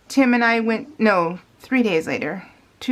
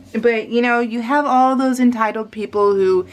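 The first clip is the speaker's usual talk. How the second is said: ordinary delivery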